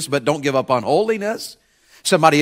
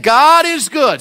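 hinted exclamation; 8 semitones higher, 4 dB louder, much slower than usual. Pretend. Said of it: "If I said".

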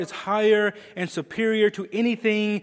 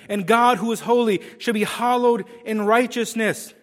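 loud, raised preaching voice; declaimed Scripture; 4 semitones higher, 6 dB louder than usual. Acted. Preacher reading holy Scripture to the church, in ringing declamation. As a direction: proclaim it high and strong to the congregation.

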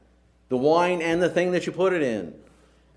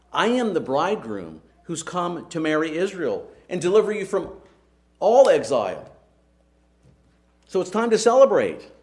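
building joyful announcement; 5 semitones higher, 4 dB louder than usual. Retold or imitated. Preacher voices Jesus' announcement.

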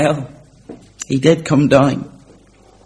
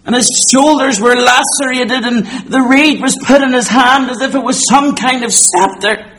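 impassioned pulpit delivery, pitched higher; full-force preaching; 10 semitones higher, 5 dB louder than usual. Acted. Preacher telling the congregation how Jesus was mocked, full-force preaching. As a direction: drive it home from the pulpit.

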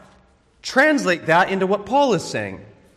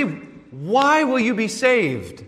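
slow question; 3 semitones higher, much slower than usual. Acted. Pastor repeats the question.

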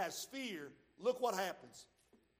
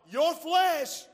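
loud preaching burst; 7 semitones higher, 11 dB louder than usual. Real, not acted.

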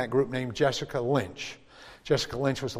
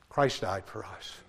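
hushed; 4 semitones lower, 7 dB quieter, slower than usual. Acted. Hushed after the climax.

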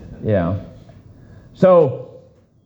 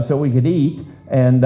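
ordinary talk, nothing marked

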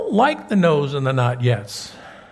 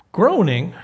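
ordinary talk

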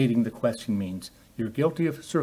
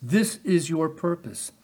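ordinary talk

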